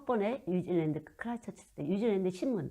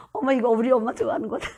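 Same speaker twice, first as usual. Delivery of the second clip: retold delight; 3 semitones higher, 9 dB louder than usual. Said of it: "retold excitement seeing the fish head".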